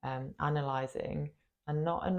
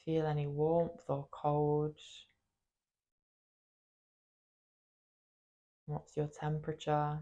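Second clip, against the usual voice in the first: guided meditation. Acted. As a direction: guide them gently into the body, leaving space after each phrase. Leads a body meditation.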